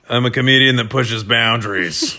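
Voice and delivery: put-on pompous comic voice; jokey, affected boast; mock self-important comedian. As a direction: declare it as a self-styled edgy comedian. Joking reply to criticism.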